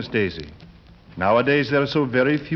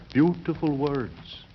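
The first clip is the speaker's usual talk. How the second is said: slow savouring murmur; 5 dB quieter, slower than usual. Acted. Lodger savours his own words.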